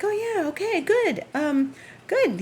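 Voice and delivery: high eager mock voice of the repairman; imitating Ronald. Repairman glad to hear the money is ready, high mock voice. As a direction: pitch it up, chirpy and pleased.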